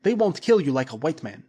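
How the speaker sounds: grim warning. A narrator's reading. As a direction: warn him coldly. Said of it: "said Krasky".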